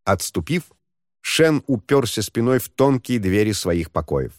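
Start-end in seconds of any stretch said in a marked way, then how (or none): none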